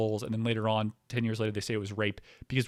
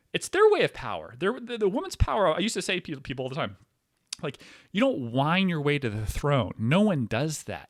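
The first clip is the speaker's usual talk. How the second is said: normal talking voice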